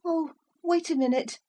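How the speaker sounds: put-on high child voice; a touch of a pleading child; pleading boy. A narrator's reading. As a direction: beg them to wait.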